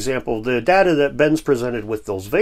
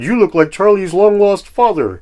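put-on higher, louder quoted kid; son's voice; 5 semitones higher, 6 dB louder than usual. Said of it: "Then my son said".

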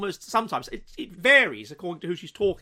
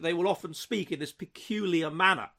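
ordinary delivery

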